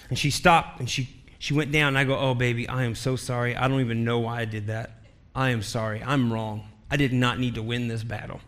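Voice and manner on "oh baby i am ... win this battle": low, subdued retold apology; teary apology. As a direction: apologize softly and low, a touch tearful. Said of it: "I started getting teary-eyed".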